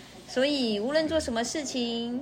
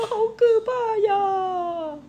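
scared little cry; 10 semitones higher, 6 dB louder, slower than usual. Acted. Country mouse scared by traffic.

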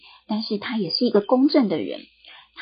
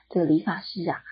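ordinary delivery, nothing marked

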